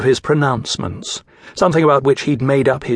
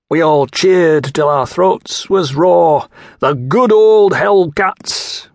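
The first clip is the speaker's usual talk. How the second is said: put-on slow, louder Mancunian accent, chanting the old cheer; Mancunian tang; 4 semitones higher, 5 dB louder, slower than usual. A narrator's reading. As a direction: slip into a proud Manchester accent, slowly. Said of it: "gone sharp and flat in a Mancunian tang".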